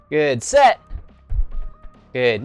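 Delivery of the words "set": high, loud count; shouted count. Shown as a demonstration. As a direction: bark the number out. Shouted count during push-ups.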